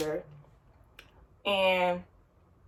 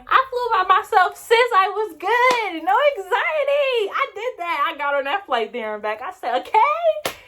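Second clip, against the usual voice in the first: high, loud triumphant brag; proud; 11 semitones higher, 3 dB louder than usual. Real, not acted. Woman bragging to her friend about flying alone, high and triumphant.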